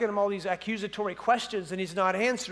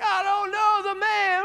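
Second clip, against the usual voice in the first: high, slow put-on voice; falsetto denial; 11 semitones higher, 4 dB louder than usual. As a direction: squeal the denial comically. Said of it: "Peter was like".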